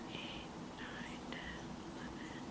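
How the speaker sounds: whispered counting; counting under breath. Real, not acted. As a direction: count softly to yourself. Counting rows quietly to herself.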